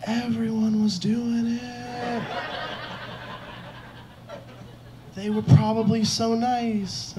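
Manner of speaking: high, drawn-out pleading with Mom; panicked placating. Retold or imitated. Sons trying to calm their crying mom, high placating whine. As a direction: coax her soothingly in a high, stretched voice. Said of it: "We're trying to calm her down".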